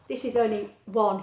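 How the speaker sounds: ordinary delivery, nothing marked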